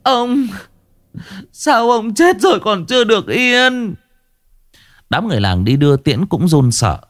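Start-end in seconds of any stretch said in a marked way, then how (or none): 0.0-3.9 high, keening lament; mourning wife wailing at the coffin; wailing grief. A narrator's reading. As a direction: wail over the dead husband. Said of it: "khóc thảm thiết".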